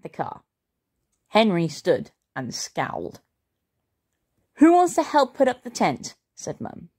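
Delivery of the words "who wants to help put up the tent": rally the family brightly; cheery mum. A narrator's reading. Mum cheerily offers a task.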